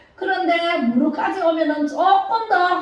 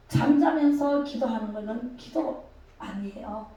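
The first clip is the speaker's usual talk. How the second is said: ordinary talk, nothing marked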